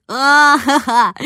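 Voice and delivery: loud acted "ah-ha-ha"; slow, loud performed guffaw; peal of laughter. A narrator's reading. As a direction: burst out in a drawn-out laugh. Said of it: "Дана залилась смехом".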